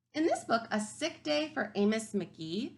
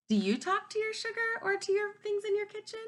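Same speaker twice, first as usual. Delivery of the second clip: high, bright, much louder teasing question; playful question; 12 semitones higher, 4 dB louder than usual. Real, not acted. Teacher teasing kids about talking to the sugar bowl, high and playful. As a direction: ask it in mock amazement.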